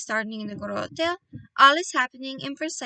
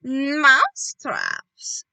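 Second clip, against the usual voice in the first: slow, louder drawn-out witch cry; witches crying; 8 dB louder, much slower than usual. Acted. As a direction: stretch the word like a chorus of witches. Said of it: "cried the witches".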